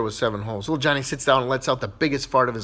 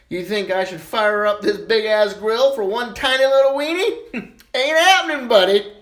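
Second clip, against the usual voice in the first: sassy put-down; 8 semitones higher, 5 dB louder than usual. Retold or imitated. Wife shoots down husband.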